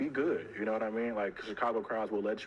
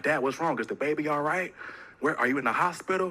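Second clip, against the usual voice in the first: Dad, what's wrong? louder and higher in hammed-up alarm; mock alarm; 6 semitones higher, 5 dB louder than usual. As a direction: play panicked son on the phone. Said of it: "I'm like".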